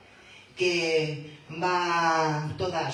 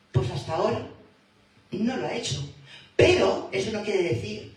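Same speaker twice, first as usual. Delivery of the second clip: usual speaking voice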